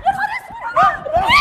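high, very loud, drawn-out cry; horrified shriek. Acted. Woman shown the last card, shrieking in horror. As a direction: shriek it in alarm.

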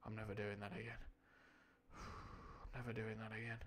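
very soft, sighing regret; spent and quiet. Real, not acted. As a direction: sigh it out, drained after a silly voice. Recovering after straining Blathers voice.

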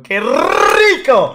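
loud, high, drawn-out cheer; delighted exclamation. Retold or imitated. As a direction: stretch it out in exaggerated delight. Acted delighted reaction in story. (exclamación eufórica y alargada)

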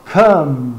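come, one syllable; explosive C. Shown as a demonstration. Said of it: "com um C explosivo".

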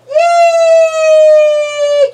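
loud high yay; cheering. Real, not acted. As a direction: cheer with joy. Cheering the baby.

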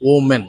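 woman with an o sound, WO-men; wrong for the plural. Shown as a demonstration. Teacher warns against this as plural, wrong pronunciation. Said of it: "not woman".

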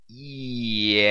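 slow, drawn-out unsure yes. A narrator's reading. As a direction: stretch the word, unsure of yourself. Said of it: "The monk drew the word out".

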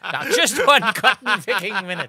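loud high laughing protest; hammed-up shout of disbelief; mock outrage. Mock outrage at partner's claim.

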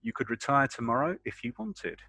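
ordinary delivery

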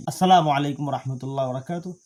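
ordinary talk

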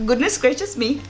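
put-on Indian accent. Quoting a TV programme, imitated Indian accent. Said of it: "Obviously that's an Indian accent".

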